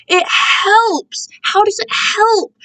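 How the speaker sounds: high, disbelieving "It helps!"; incredulous. Real, not acted. Doubting that thinking of dogs helps, she asks in high disbelief. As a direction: question your own claim in disbelief. Incredulous at her own remark.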